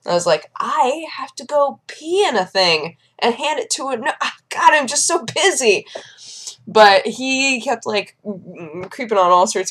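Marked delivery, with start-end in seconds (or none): 0.6-5.9 higher, fussy mock brush-off; flustered excuse. Patient brushes off shoe man, mock flustered. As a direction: beg off in a raised, harried voice.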